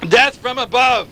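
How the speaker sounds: ordinary talk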